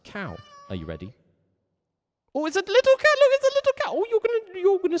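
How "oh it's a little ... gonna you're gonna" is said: gushing over a little critter; high-pitched cooing. Real, not acted. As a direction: coo loudly at a tiny animal, very high.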